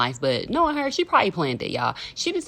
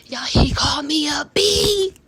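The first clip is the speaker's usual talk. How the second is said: outraged disbelief; 12 semitones higher, 3 dB louder than usual. Real, not acted. Outraged telling of insult.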